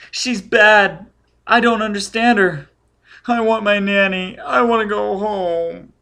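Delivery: put-on high, loud, terrified child voice; shrieking child. A narrator's reading. Scared child begging for nanny, shrieking high. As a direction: wail like a panicked little boy. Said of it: "The child was shrieking and shaking".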